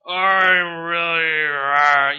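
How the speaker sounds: slow, loud, moaning pirate; hammed-up queasy pirate moan; seasick pirate. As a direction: drag it out like a sick sailor. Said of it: "and they probably".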